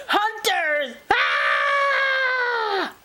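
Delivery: very high mock panic shout; storybook yell. A narrator's reading. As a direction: cry out high into a long scream. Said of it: "and yelled".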